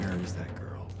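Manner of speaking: low hushed whisper; whispered search for a lost girl. Acted. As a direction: breathe it out, barely voiced. Reading a game line under breath.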